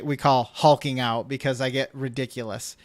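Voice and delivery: ordinary talk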